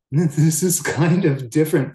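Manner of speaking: amused chuckle. Real, not acted. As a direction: chuckle through the understatement. Laughs at his own understatement.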